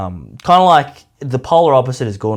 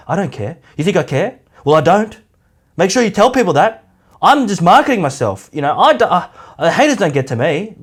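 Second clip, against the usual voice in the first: mock insecure protest; 6 semitones higher, 4 dB louder than usual. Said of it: "desperately trying to tell people how much he doesn't care".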